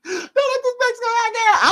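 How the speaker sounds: high, tearful pleading; crying prayer. Retold or imitated. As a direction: beg through half-sobs. Praying in terror on hill.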